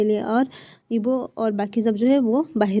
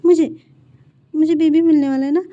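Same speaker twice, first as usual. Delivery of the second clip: put-on higher, louder thrilled child voice; a little boy who can't believe his luck; delighted child; 5 semitones higher, 7 dB louder than usual. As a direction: son thrilled about a baby brother, higher and louder. Child delighted about baby.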